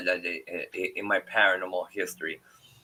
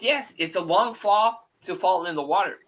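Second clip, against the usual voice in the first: lightly voiced daughter, higher pitch; imitating young daughter; 8 semitones higher than usual. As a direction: quote your girl's explanation, pitched up. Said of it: "she goes".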